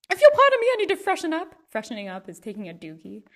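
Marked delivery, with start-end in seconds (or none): 0.1-1.6 high, loud, over-polite plea; mock-fancy request. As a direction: excuse yourself with exaggerated gentility. Acting out fancy ways to ask.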